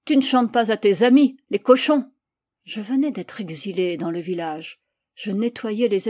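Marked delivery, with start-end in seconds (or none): 0.1-2.1 loud, mocking arrogance; arrogant. A narrator's reading. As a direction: taunt with arrogant contempt, voice raised. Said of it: "apostropha une voix arrogante". (d'une voix arrogante et forte)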